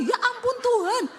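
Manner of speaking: high, loud dismayed exclamation; panicked cry. Retold or imitated. Wife crying out in dismay as the car breaks, high panicked exclamation. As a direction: cry out to God in shock.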